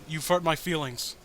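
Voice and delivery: ordinary talk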